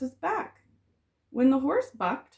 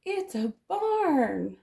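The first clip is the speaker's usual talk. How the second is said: slow, drawn-out dismayed cry; 4 semitones higher, slower than usual. Acted. Chicken sees the horses' barn, dismayed cry. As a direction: let it dawn with alarm.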